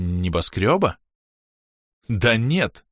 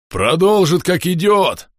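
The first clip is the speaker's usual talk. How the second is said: уверенно и громко, выше обычного; прогреметь с полной уверенностью; громкий рокот; 9 semitones higher, 6 dB louder, slower than usual. Acted. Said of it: "пророкотал Бом". (громогласно рокочет)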